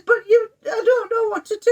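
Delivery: very high mock-tearful wail; imitating crying. Wife imitates husband unable to decide, crying. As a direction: wail helplessly in a put-on crying voice. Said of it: "he started crying".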